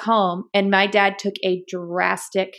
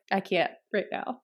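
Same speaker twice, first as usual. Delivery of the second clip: much softer, tired and fed up; frustrated, under breath; 10 dB quieter than usual. Retold or imitated. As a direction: sigh it out, worn down. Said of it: "I would get so frustrated".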